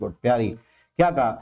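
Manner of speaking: ordinary talk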